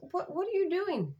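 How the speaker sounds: high-pitched, disbelieving outrage; indignant challenge. Retold or imitated. Mom confronting the bus driver over her son, indignant.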